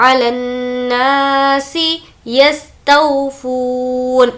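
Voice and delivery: अलन्नासी with the doubled न held as a nasal hum; nasal ghunna on nn. Shown as a demonstration. Teacher demonstrating ghunna on अलन्नासी for students. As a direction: hum through the न्न of अलन्नासी. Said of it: "नून पर तजदीद है इसलिए गुन्ना होगा".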